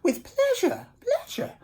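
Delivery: high gushing voice with a laugh; flamingo delighted. Acted. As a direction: trill it happily, way up high. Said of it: "said the flamingo".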